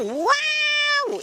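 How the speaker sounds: high, drawn-out squeal of wonder; amazed wow. Pretend. Showing children a toy find, amazed. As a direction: let out a long, high wow.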